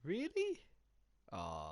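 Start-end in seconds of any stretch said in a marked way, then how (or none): 0.0-0.6 high surprised rise; surprised really. Real, not acted. Friend hears the guy's voice is annoying, surprised. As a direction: disbelief at the remark.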